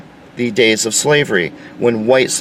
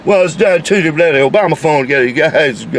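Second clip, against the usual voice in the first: mock inarticulate rioter; 4 semitones higher than usual. Speaker mocks a rioter answering a reporter's microphone. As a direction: mumble an angry, garbled rant. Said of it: "so inarticulate that you can't even understand".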